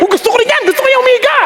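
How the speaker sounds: high-pitched mock eagerness; acted starstruck wish; excited admiration. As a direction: gush like an eager copycat. Re-enacting his admiring wish to imitate.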